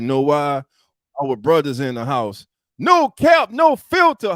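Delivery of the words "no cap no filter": hype shout. Real, not acted. Host hyping his show slogan.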